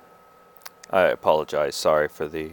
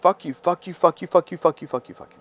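a loud, raised string of curses; repeated retold yelling; 7 semitones higher, 4 dB louder, faster than usual. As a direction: hurl them out again and again. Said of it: "I was screaming and yelling".

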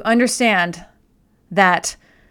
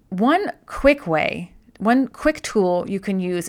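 normal talking voice

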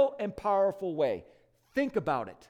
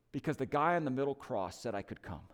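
humble thief; 6 semitones lower, 6 dB quieter than usual. Retold or imitated. Thief answering the angel at heaven's gate, meek and low. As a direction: lower and softer, a plain humble reply.

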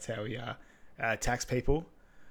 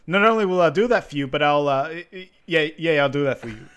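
loud, fast start breaking into stammering; imitated stutter; 3 semitones higher, 8 dB louder than usual. Player promises the coach, mock eager stutter. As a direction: mimic an eager stammer, tripping on "yeah". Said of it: "Little stutter boy".

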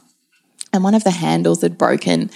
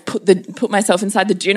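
usual speaking voice

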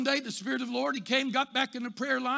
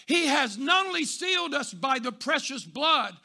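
preaching at full force; 4 semitones higher, 4 dB louder than usual. Real, not acted. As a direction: drive it home to the congregation.

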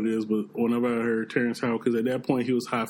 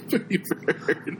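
slow breathy parrot squawk through laughs; 6 semitones higher, much slower than usual. Retold or imitated. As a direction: drag it out like a parrot.

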